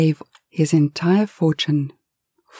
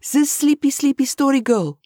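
put-on higher, scornful Spanish-accented jab; 9 semitones higher than usual. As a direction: sneer at the storyteller, Spanish accent. Eustacio's accented voice.

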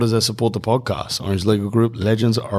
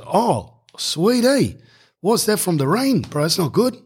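put-on higher, lightly done Islander accent; 8 semitones higher than usual. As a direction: greet him with a light Islander lilt. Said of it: "And he goes".

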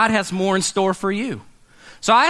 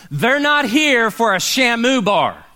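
ordinary delivery

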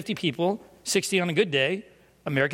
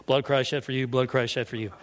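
low, monotonous repeated serving line; routine recitation; 6 semitones lower than usual. Retold or imitated. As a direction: rattle off the communion line on autopilot. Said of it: "I could do this all day".

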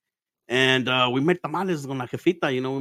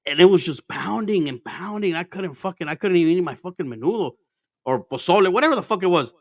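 normal talking voice

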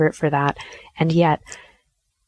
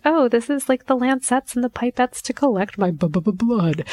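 high sing-song cover for nerves, stumbling on blood; falsely cheerful stammer; 6 semitones higher than usual. Acted. Mother explaining the kit to her daughter, nervously upbeat. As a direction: start breezy, then stutter on the dreaded word.

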